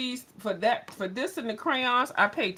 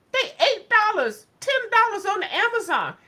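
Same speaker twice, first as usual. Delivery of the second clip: mock disbelief; 11 semitones higher, 6 dB louder than usual. Mocking Amazon prices.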